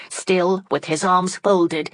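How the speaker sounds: usual speaking voice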